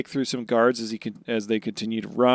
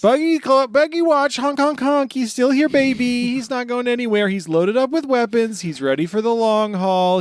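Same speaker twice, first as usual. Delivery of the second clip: much higher and louder hype voice; silly clown; 12 semitones higher, 6 dB louder than usual. Pretend. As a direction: shout it like a circus barker. Hyping Buggy the Clown.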